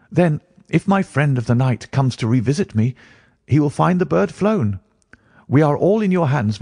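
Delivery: eager client Phelps. Acted.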